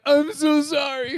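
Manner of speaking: high, slow, whimpering apology; mock crying. Friend on the phone, apologizing through mock tears. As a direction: play the sobbing friend, half in jest. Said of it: "but he was, like, crying".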